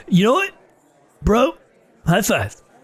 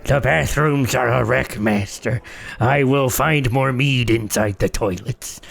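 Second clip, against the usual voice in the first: dwarf servant; 5 semitones lower than usual. Acted. Snorri the dwarf reporting to his master, low and meek.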